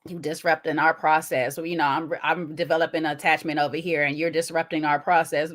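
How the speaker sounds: low mock man. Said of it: "quoting him".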